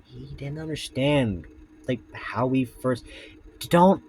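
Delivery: low, soft story reading; story narration. A narrator's reading. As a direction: read the tale in a hushed voice. Reads Foxy story aloud.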